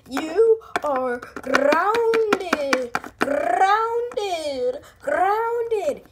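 very slow, drawn-out stern mom. Acted. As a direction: stretch out grounded like a decree. Mom repeating punishment.

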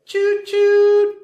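loud high whistle choo-choo; train whistle. Pretend. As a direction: call it out like a train. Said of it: "a train says".

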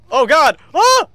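loud, high yelp of alarm; startled cry. Real, not acted. Player caught off guard in the game, startled yelp.

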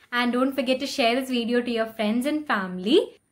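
ordinary talk, nothing marked

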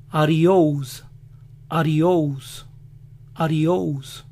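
joking gringo adiós, American accent. Shown as a demonstration. Showing how Americans joke adiós, American accent. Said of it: "Lo dicen".

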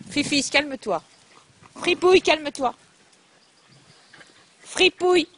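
loud firm call to settle the dogs; sharp loud "Fripouille, calme-toi" at the dog. Real, not acted. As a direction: raise your voice to calm an excited dog. Calling dogs to calm down.

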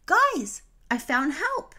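high, loud joyful cry; Dory crying out to Marlin and Nemo that she found help; excited cry. A narrator's reading. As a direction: shout the news up high. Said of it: "Dory cried".

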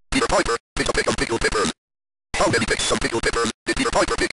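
rapid-fire robotic tongue-twister; very quickly. Shown as a demonstration. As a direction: rattle it off at top speed. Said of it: "I can even talk very quickly".

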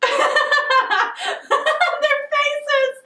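high laughter breaking into words; helpless high-pitched giggles; bursting out laughing. Real, not acted. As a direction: laugh hard, then squeal the words through it.

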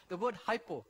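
usual speaking voice

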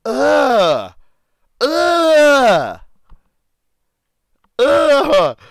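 high, drawn-out "ugh" falling away; loud disgusted groan. Real, not acted. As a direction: groan loudly in revulsion. Disgusted reaction to something seen.

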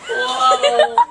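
high, loud drugged amazement; marvel, woozy and giggling; dazed whoa. Real, not acted.

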